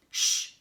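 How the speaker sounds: sharp loud shush. Acted. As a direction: hush them with a forceful shh. Wolf hushes piranha.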